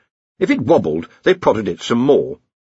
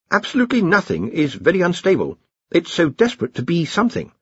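earnest, higher-pitched explainer; Ponder; 9 semitones higher than usual. Acted. Junior wizard tells the Arch-Chancellor how it started, earnest and deferential. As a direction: explain deferentially, feeling for the words.

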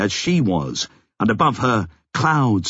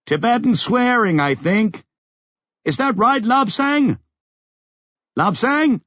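high, raised voice; calling out; 13 semitones higher than usual. A narrator's reading. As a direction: call to someone over the noise. Sally answers over the roar.

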